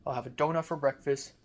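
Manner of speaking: ordinary delivery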